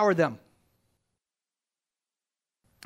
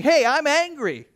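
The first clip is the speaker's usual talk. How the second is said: slow, higher, louder declaration of anger; preacher voicing God's anger, raised and slow; voiced anger; 7 semitones higher, 5 dB louder than usual. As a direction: let God's anger ring out.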